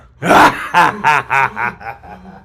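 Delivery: loud hammed-up villain cackle; evil laugh. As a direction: throw back a big evil laugh.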